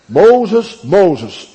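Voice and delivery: loud, high call across a distance; a booming divine summons; God calling out. Acted. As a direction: call the name out like a voice from heaven. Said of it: "hij werd persoonlijk geroepen".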